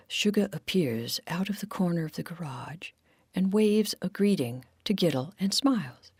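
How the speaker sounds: ordinary delivery, nothing marked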